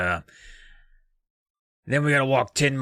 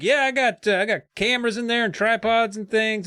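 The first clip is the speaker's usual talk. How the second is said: cheery dad; 10 semitones higher than usual. Retold or imitated. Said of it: "And Dad's like".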